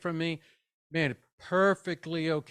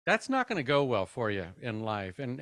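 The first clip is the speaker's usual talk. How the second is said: normal talking voice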